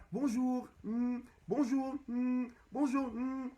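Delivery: high, slow, sugary mock bonjour; mock fake greeting. Mocking two-faced coworkers greeting him, high slow put-on voice. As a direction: drawl it out like a fake-friendly coworker.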